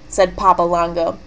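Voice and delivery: ordinary delivery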